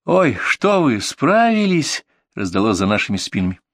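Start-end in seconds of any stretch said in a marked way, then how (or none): 0.0-2.0 high, slow, drawn-out surprised question; the wife gasps in surprise at the medics. Acted. Wife appears behind the medics, surprised they managed.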